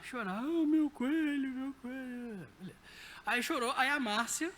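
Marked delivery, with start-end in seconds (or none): 0.4-2.5 slow mock wail of a little boy, fading; imitating crying child. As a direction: play the child sobbing over his rabbit, lightly. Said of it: "Aí começou a chorar".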